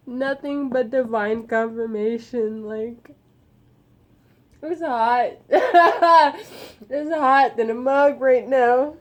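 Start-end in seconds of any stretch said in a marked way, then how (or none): 5.4-6.6 high, loud burst of laughter; real giddy laugh. Real, not acted. Laughs at being hot.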